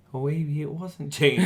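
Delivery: slow, high-pitched sheepish realisation; dawning realisation. Retold or imitated. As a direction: let it sink in, drawn out and high. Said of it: "And I was like".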